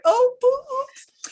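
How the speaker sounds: very high, slow, squeaky "oops"; a silly high-pitched oops; mock oops. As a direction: caught out, playful embarrassment. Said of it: "I was like".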